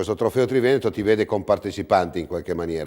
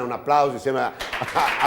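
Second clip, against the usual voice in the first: normal talking voice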